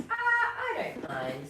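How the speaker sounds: high, slow and easygoing; cheerful little nut voice. Acted. Snugglepot shrugging off his bad luck, high and slow.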